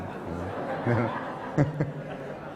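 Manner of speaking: low, quiet laugh; soft chuckle. Real, not acted. Preacher amused at Jabir's predicament, soft chuckle. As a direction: chuckle softly under the breath.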